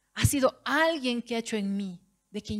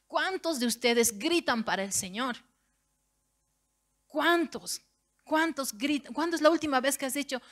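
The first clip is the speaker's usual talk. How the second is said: ordinary delivery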